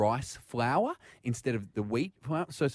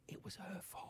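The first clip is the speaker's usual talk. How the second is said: whispered blame; 7 semitones higher, 23 dB quieter, faster than usual. Real, not acted.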